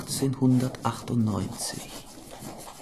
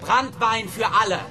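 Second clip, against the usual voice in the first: Ausruf; 10 semitones higher, 5 dB louder than usual. Acted. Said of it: "Und ruft".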